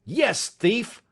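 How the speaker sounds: father roaring at his son; roared. A narrator's reading. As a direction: roar the accusation. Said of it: "I roared".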